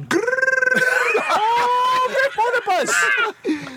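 mock monster. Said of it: "I would have gone".

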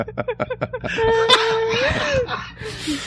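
shrieking laughter. Real, not acted. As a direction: howl with laughter. Big laugh.

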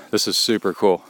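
talking while jogging, breathing hard; while running. Real, not acted. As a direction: speak mid-run on a trail. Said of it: "we're gonna record while I run".

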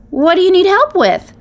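very high, loud little-creature voice; eager piping up. A narrator's reading. Runt popping through the needles to the stranger, piping up.

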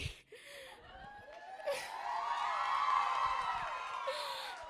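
long, high shrieking laugh; loud squealing laughter; loud joyful laugh. Real, not acted. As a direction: burst into a giddy, delighted laugh. Bursts out about meeting a guy.

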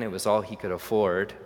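ordinary delivery

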